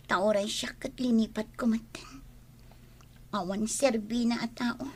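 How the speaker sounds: unvoiced bitter whisper. Daughter whispering bitterly about the man to her mother. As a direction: hiss it under the breath.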